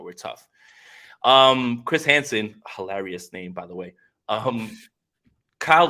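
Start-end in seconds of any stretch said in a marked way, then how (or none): 0.0-0.5 mock tough guy. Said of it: "trying to tell you all the time".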